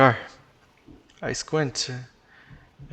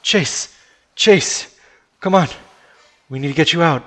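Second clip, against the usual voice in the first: high, loud, desperate calls; urgent shouting; 4 semitones higher, 10 dB louder than usual. Acted. Friend urges Chase out of sinking car, urgent shouting. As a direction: yell to get him moving.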